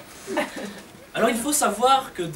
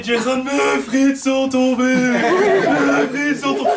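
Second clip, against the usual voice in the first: put-on slow whiny kid, a touch higher; whining child; 4 semitones higher, 4 dB louder, slower than usual. Jason whining to his mother about his fallen fries. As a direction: whine about dropped fries.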